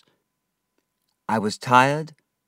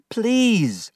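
pleading cry; 14 semitones higher, 4 dB louder than usual. A narrator's reading. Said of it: "I cried".

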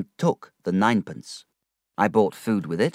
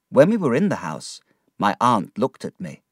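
ordinary delivery

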